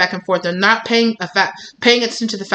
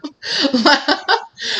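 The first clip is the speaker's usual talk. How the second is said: high, short giggle; real surprised little laugh; embarrassed laugh; 8 semitones higher than usual. Real, not acted. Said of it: "I don't know what that is".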